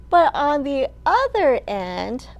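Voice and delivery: ordinary talk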